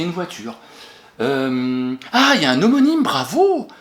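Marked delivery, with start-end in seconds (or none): 2.1-3.7 high, loud burst of joy; delighted praise. Real, not acted. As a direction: cheer the pupil who found it. Pupil finds the homonym.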